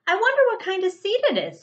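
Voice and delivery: high, fast and eager; excited wondering. Acted. Splat the cat wonders about a strange seed, high and eager. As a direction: bubble over with curiosity.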